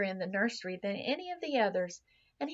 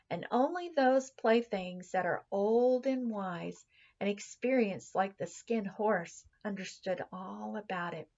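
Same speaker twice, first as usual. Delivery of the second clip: usual speaking voice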